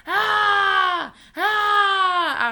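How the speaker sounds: high, loud screech; story-time scary screech, held out. A narrator's reading. Child clown running at two trick-or-treaters, screeching. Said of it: "I screeched".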